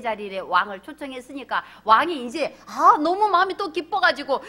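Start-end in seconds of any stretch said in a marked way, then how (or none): none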